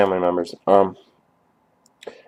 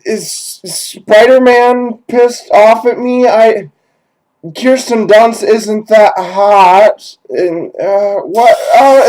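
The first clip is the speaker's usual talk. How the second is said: much higher, louder, slow bewildered babble; panicked dazed victim; 9 semitones higher, 5 dB louder, slower than usual. Pretend. Sprayed victim stumbling around, asking where he is, high panicked confusion. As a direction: play a blinded victim lost and whimpering in confusion.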